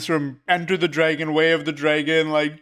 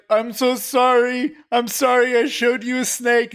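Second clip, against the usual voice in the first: a hint of hammed-up crying; mock crying; 7 semitones higher than usual. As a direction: whimper it like a fake crier. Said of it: "pretending to cry".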